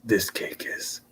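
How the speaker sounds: soft breathy whisper reading comments; hushed, unvoiced whisper. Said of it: "is what Wine Boy whispers".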